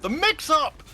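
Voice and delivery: loud, high hype yell; excited shout. Real, not acted. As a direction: scream the big moment. Caster shouting big play.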